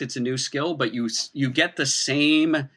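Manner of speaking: normal talking voice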